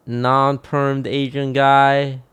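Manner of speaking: ordinary talk, nothing marked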